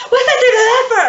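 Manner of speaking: hammed-up, loud high wail; over-the-top sobbing howl; crying. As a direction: bawl it out in mock anguish. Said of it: "Hamish started crying".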